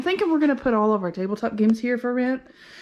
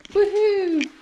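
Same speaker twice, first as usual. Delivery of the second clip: excited whoop; 12 semitones higher, 3 dB louder than usual. Real, not acted. Finds the light switch.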